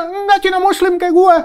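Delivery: high loud retort; retold shout. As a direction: snap back at the rioter. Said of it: "Saya bilang gitu". (teriakan membantah perusuh)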